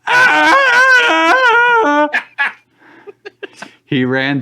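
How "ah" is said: long, high, loud scream; hopeless scream. Acted. Tyler charges the monster on request, screaming hopelessly. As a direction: let out one sustained hopeless scream. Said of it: "let out a hopeless scream".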